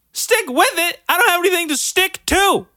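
high, loud, outraged; indignant disbelief. Real, not acted. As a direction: squeal in disbelief. Outraged at parents' logic.

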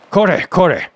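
short annoyed outburst; a touch of gruff annoyance; rough exclamation. A narrator's reading. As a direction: exclaim it with mild alarm. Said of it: "roughly exclaimed the soba man".

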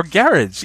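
garage said GARE-idge, stressed on the first syllable; alternative garage pronunciation. Shown as a demonstration. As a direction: shorten the end of garage to "-idge". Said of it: "you're saying garage, which is even further away".

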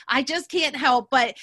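normal talking voice

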